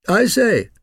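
loud, high-pitched puppy call; loud exclamation. A narrator's reading. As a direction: pipe up loudly, pitched well up. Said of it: "said the Old English Sheepdog puppy loudly".

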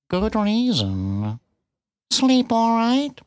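very slow, spat-out cat voice; bitten-off words. A narrator's reading. Cat explaining queens to the piglet, words bitten off. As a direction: spit out each word separately. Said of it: "seemed to bite off its words and spit".

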